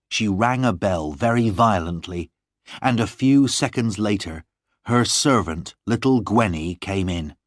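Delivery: ordinary delivery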